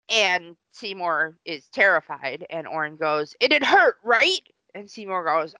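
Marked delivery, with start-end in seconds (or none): 3.4-4.4 sadistic dentist. Retold or imitated. Dentist taunting patient.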